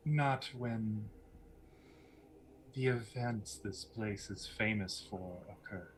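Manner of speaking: soft, measured pauses between phrases; guarded, enigmatic woman; mysterious villainess. Acted. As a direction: let each phrase fall away quietly. Game master voices Lucretia.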